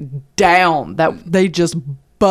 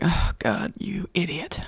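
soft, breathy exasperated aside; exasperated mutter; 9 dB quieter, slower than usual. Retold or imitated. Wife shaking her head at husband, muttered exasperation. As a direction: sigh it under your breath. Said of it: "woman shaking her head going".

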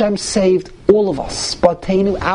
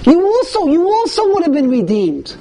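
emphatic; 8 semitones higher than usual. Real, not acted. Emphatic teaching point.

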